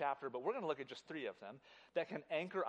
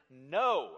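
"no" punched much louder; stress on no; 10 dB louder than usual. Shown as a demonstration. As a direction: hit "no" hard. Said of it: "starts his sentence with the word".

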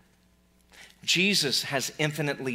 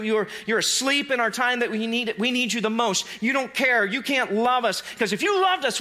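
desperate accusation; 7 semitones higher than usual. Acted. Disciples accusing sleeping Jesus of abandoning them, desperate. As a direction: hurl it at him like a betrayed friend.

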